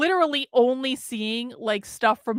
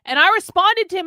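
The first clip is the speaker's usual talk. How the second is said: normal talking voice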